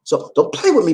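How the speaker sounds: ordinary talk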